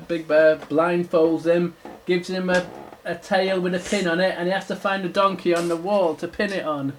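ordinary delivery

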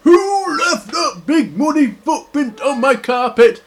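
high, loud indignant bear; booming, swooping cartoon bear voice; Big Bear. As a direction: demand it like an affronted storybook bear. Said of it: "and Big Bear goes".

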